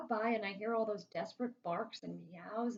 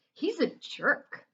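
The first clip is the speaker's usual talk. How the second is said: loud, drawn-out blunt judgement; emphatic put-down; 6 semitones higher, 7 dB louder, much slower than usual. A narrator's reading. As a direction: say it with no subtlety, higher and slower. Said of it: "subtlety is not my strong point".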